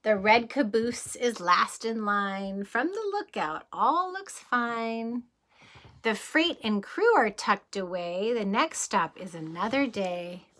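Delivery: calm bedtime read-aloud; storybook reading. Acted. Reading the departing train page to children, calm read-aloud. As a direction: let the train roll gently away. Reads picture book aloud.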